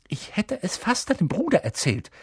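high-pitched, prim reproach; pointed, tart. A narrator's reading. Hermine threatens Ron with his brother, pointed. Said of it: "sagte Hermine spitz".

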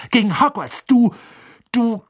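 high, sputtering outrage; outraged. A narrator's reading. Ron accuses Hermine of siding with the rival, outraged. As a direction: accuse in a high voice, lost for words.

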